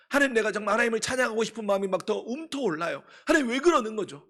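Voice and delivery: a believer pours out a loud, high prayer of thanks; impassioned prayer. Acted. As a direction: cry out to God with the whole heart. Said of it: "하나님, acted prayer of believer".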